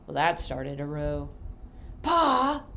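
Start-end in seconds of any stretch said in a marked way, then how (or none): 2.0-2.6 sharp high shout at Pa; yell. Acted. Cousin Homer yelling to his father at supper. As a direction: yell across the table, much higher and louder. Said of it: "yelled Cousin Homer".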